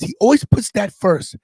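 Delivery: normal talking voice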